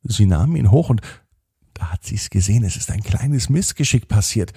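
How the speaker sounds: ordinary delivery, nothing marked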